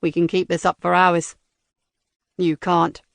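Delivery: low, flat disguised robot voice; cold robotic interrogator. Acted. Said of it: "said the robotic voice".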